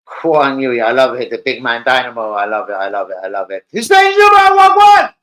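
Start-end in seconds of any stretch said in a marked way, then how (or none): 3.7-5.1 very high, loud announcer call; hype shout. Real, not acted. Streamer bellowing a caller's name in hype. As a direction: belt the name out like a ring announcer.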